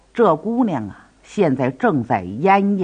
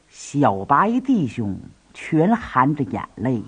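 plain reading voice

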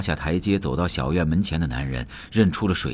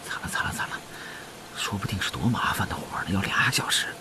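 hushed, breathy fretting; can't hold back a whispered moan of dread; whispered dismay; 7 dB quieter than usual. A narrator's reading. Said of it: "德宝忍不住说". (压着嗓子小声叫苦)

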